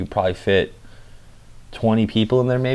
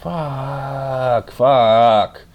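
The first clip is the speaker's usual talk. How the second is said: frustrated swearing; 4 semitones higher than usual. Retold or imitated. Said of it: "I'm like".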